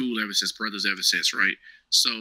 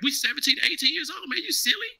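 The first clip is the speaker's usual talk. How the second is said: incredulous scoff. Retold or imitated. Telling the guys he won't join their poker night, scoffing high. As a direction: voice climbing much higher in disbelief.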